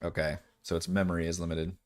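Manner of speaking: ordinary delivery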